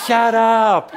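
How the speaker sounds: high, loud outburst through laughter; playful shout. Pretend. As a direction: yell it out in fun. Playful group shout amid laughter.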